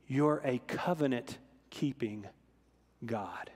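slow, soft, fading almost to nothing on God; hushed reverence. Retold or imitated. Preacher repeats a girl's cry to his congregation, slow and hushed. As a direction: let it sink to a hush, savouring each word.

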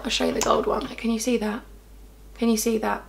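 soft, hushed close-up talk; hushed showing. Real, not acted. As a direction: murmur while showing the swatch. Quiet swatch showing.

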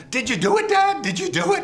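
very high, loud excited squeal; imitating brother Jimmy, high and loud; gleeful kid brother. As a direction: egg him on like a delighted boy. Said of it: "And he's laughing".

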